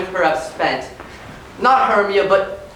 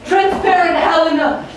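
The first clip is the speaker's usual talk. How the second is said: passionate; 9 semitones higher than usual. Acted. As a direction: swear it with passion. Lysander wakes, bewitched, proclaiming love.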